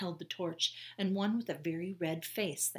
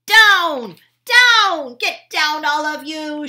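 much higher and louder repeated commands; shouting; 10 semitones higher, 21 dB louder, slower than usual. Acted. Annie shouting orders at the guards. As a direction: drive them down with each shout. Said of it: "shouted Annie".

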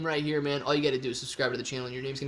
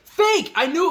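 high, loud victory cry; triumphant shout; 12 semitones higher, 8 dB louder, slower than usual. Real, not acted. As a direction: shout it like you won. Triumphant at guessing right.

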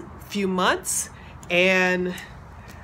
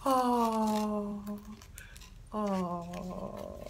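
on the verge of tears; 7 semitones higher, 7 dB louder, slower than usual. Real, not acted. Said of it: "I'm gonna cry".

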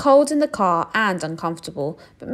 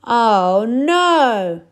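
higher, louder fed-up groan; weary groan; 4 semitones higher, 9 dB louder than usual. Acted. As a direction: groan like a worn-out bear. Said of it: "said Mister Bear".

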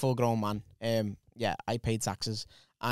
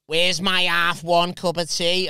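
loud, slow, higher demand; slow loud bossy demand; demanding boss; 5 semitones higher, 8 dB louder, much slower than usual. Retold or imitated. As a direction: bellow for your tea like a petty boss.

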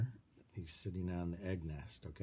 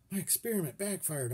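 moaning; 8 semitones higher, 4 dB louder than usual. A narrator's reading. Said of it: "Uncle Cecil moaned".

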